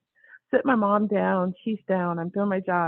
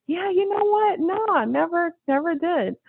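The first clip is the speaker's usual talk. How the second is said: breezy brush-off; 9 semitones higher than usual. Retold or imitated. Brushing off modeling question.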